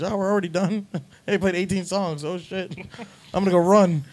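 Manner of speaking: giddy mock coked-up musician; laughing high. Musician on stage, giddy and laughing. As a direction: rush through it laughing.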